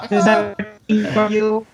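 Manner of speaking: slow, stiff robot voice. Pretend. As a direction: talk like a machine testing itself. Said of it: "That's like my fake robot voice".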